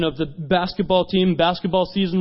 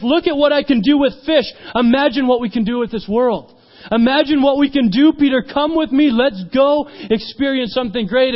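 high, loud, fervent invitation; rousing preacher's appeal; impassioned urging; 7 semitones higher, 5 dB louder than usual. Retold or imitated. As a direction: urge them on with big vision. Jesus urges Peter to come.

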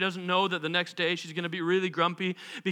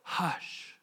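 low-key whisper; whispered hush; 4 semitones lower, 9 dB quieter than usual. A narrator's reading. Jesus speaks to the storm, whispering calmly. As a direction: hush it softly, barely voiced. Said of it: "spoke to the storm".

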